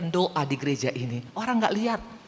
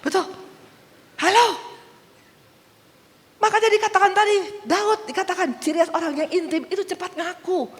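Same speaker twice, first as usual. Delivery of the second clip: preaching shout; 13 semitones higher than usual. Acted. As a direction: proclaim it to the hall. Preaching at full force.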